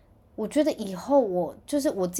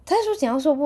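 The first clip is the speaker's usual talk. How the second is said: higher and louder, stunned disbelief; amazed; 7 semitones higher, 8 dB louder, faster than usual. Retold or imitated. Mom echoes the brother's translation, higher, louder, amazed. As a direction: repeat it as if you can't believe it.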